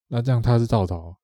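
ordinary talk, nothing marked

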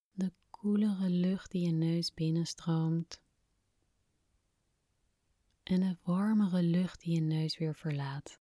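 hushed guided meditation voice; breathy, calm whisper guiding relaxation. Acted. Guided relaxation exercise begins.

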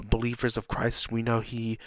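normal talking voice

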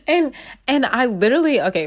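normal talking voice

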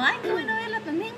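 mock sweetness returned to a former boss; hypocritical politeness. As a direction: sound delighted without meaning a word. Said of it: "pero súper hipócrita".

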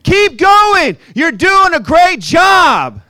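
loud, high, slow cheerleading yell; cheery shouted encouragement. Retold or imitated. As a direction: bellow it like an overeager coach. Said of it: "visual of my neighbor poking his head".

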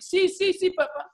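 very high mock child voice; high-pitched imitation of a compliant child; obedient little son. Young son answers his father's scolding.

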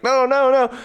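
high-pitched, hammed-up protest; mock panic. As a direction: plead in a squeaky mock panic. Villain wrestled to the ground, pleading.

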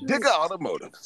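high-pitched mock ad sign-off; commercial tagline. Closing the fake car commercial, high announcer voice. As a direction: sing out the dealer name like a jingle tag. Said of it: "how some of the commercials say".

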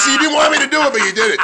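ordinary delivery

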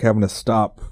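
ordinary delivery

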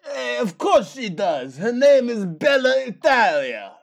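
Watto voice. Acted. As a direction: do Watto from Star Wars. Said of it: "I realized what voice I was doing".

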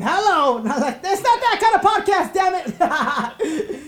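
loud laughing protest. Real, not acted. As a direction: laugh it off loudly. Catches his own innuendo, laughing.

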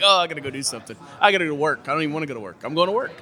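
lightly acted, eager-to-escape excuses; mock excuse-making. Friends dodging a college acquaintance with excuses, mock excuse-making.

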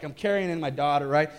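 ordinary delivery

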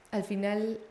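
ordinary delivery

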